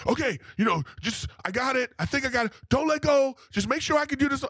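put-on a nervous child on a bike; nervous kid. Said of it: "I'm just talking to myself".

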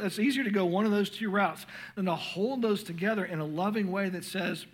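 ordinary delivery

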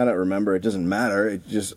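ordinary talk, nothing marked

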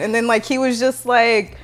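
usual speaking voice

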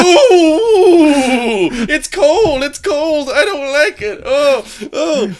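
high squealing mock whine, laughing. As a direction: squeal it out like you can't take it. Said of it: "and I was like".

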